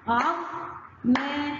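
عظمة split slowly into syllables. Shown as a demonstration. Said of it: "يبقى ننطق مع بعض ونقطع".

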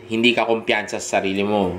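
normal talking voice

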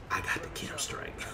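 hushed, teasing whisper; gloating whisper. Real, not acted. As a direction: whisper the win to a beaten rival. Teasing the rival with his win.

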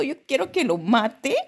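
usual speaking voice

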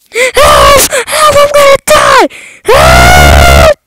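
loud panicked scream; screaming for help. Pretend. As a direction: scream for help as you fall. Falling from the sky.

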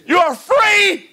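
slow, loud proclamation; triumphant shout. Acted. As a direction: ring it out over the church. Jesus voiced, congregation answers.

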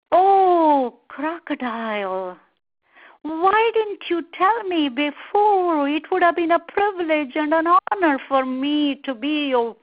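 gushing, drawn-out "oh", high and loud at first; smooth-talking monkey. Acted. Monkey tricks crocodile who wants his heart, gushing. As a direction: lay on the flattery slowly and emphatically.

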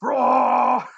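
loud drawn-out beast roar; a beast roaring at the door; monster roar. Acted. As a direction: let out a big roar. The beast roars.

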